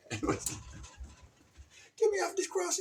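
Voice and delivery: high squeaky kitty voice; cat voice. Acted. Voicing the crucified toy cat, high whine. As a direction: whine like a little trapped cat. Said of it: "Crucifixion Kitty".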